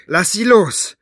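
high, loud cry to let go; shouting. A narrator's reading. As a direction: shout it at the attacker. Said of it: "schrie Maggie".

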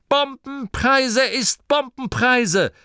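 high, slow, emphatic retort; sharp retort. A narrator's reading. Driver overrules the objection about running people over, sharply. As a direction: spell it out sharply, pitched high. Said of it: "erwiderte Angelo scharf".

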